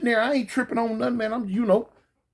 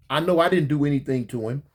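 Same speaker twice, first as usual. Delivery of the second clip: ordinary talk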